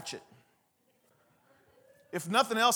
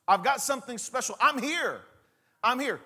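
higher, louder eager call; excited announcing; 6 semitones higher, 5 dB louder than usual. Acted. Preacher acting out an excited announcement. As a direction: announce it with a thrill.

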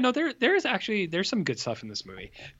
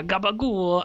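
gabagool said higher and louder, Italian-American style; Italian-American accent; 6 semitones higher, 4 dB louder, slower than usual. Retold or imitated. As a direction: toss out gabagool like a mobster. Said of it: "the Italian accent".

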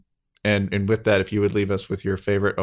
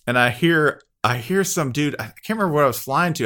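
ordinary talk

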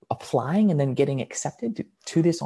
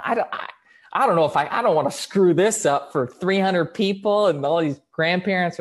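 loud, stumbling objection; flustered protest; 4 semitones higher, 6 dB louder than usual. Retold or imitated. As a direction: protest in mild mock panic. Retold protest at being asked.

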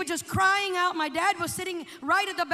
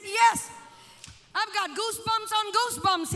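higher, emphatic quoted reply; worked-up retelling; 5 semitones higher, 4 dB louder than usual. Retold or imitated. Father telling son what gripped him, worked-up. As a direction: insist on it, building in intensity.

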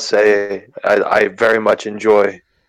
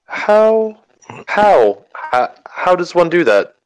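alarmed stammer; 8 semitones higher, slower than usual. Acted. Player character stammers alarmed.

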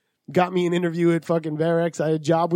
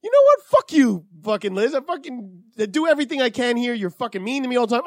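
retold yelling; 4 semitones higher than usual. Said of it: "I'm like".

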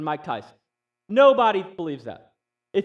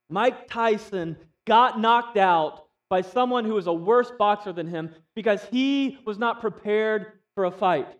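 usual speaking voice

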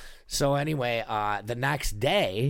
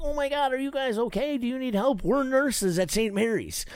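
high, fussing caring-lady voice; concerned nurses; 14 semitones higher than usual. Retold or imitated. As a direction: play two worried nurses offering help.

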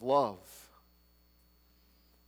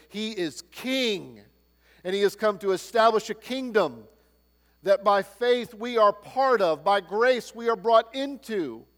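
preaching at full force; 6 semitones higher, 7 dB louder than usual. Real, not acted. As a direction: preach it, build the kingdom line.

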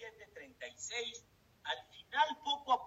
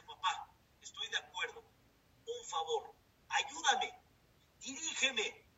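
pleading son; 8 semitones higher than usual. Retold or imitated. Son pleads with his father.

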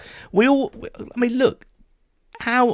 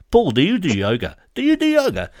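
mock nagging flatmate, second "Do you do yoga" jumping much higher; imitating Brian; 7 semitones higher than usual. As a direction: pester him with the yoga question. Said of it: "it's just like that all the time".

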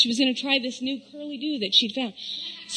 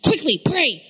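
higher, hurried breathless praying; frantic rushed prayer; 6 semitones higher than usual. Retold or imitated. Mom praying frantically as the school bus approaches. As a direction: rattle off the prayer in a panic. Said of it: "Instead, it's like".

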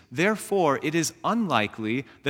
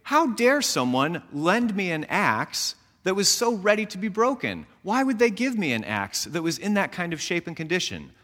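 indignant; 6 semitones higher than usual. Retold or imitated. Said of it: "And so we say".

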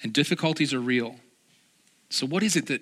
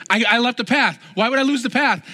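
retold panic; 7 semitones higher, 5 dB louder than usual. Lost in forest, panic.

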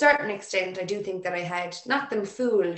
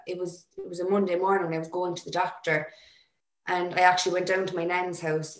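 ordinary talk

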